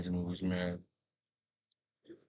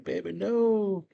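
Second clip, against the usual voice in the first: pleading; 12 semitones higher, slower than usual. Retold or imitated. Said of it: "Anton's like".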